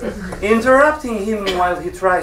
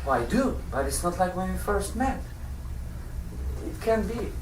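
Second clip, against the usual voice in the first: soft, low-key husband; quiet, half-hearted reassurance to a partner; subdued admission; 3 semitones lower, 12 dB quieter than usual. Acted. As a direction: drop the voice right down. Husband subdued, talking of faded desire.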